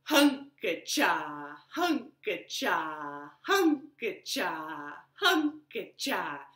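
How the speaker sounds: slow rhythmic chop sound; sawing chant. Shown as a demonstration. Storyteller makes the woodcutting sound for children, slow. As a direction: chant each hunka-cha slowly like chopping. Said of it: "it went like this".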